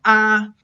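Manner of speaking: sing-like steady "ah", no rise or fall; high flat tone. Shown as a demonstration. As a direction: sustain "ah" evenly without any glide. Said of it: "first tone is a high flat tone".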